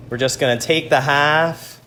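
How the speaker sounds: ordinary talk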